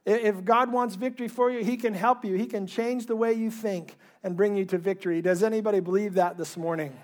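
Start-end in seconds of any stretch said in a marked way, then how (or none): none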